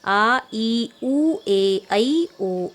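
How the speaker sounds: आ, ई, उ held a bit longer and pressed; drawn-out long vowels for pupils; long vowels with stress. Shown as a demonstration. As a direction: lean on each long vowel आ, ई, ए a little. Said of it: "इसमें थोड़ा जोर देके बोलते हैं".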